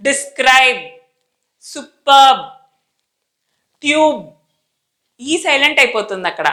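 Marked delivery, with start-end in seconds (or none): 0.1-4.2 DESCRIBE, SUPERB, ట్యూబ్ each closed firmly on B; isolated teacher models with sealed lips; tight final B. Shown as a demonstration. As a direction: clamp the end of each word. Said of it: "lips చాలా టైట్గా బిగిచ్చేస్తున్నాం".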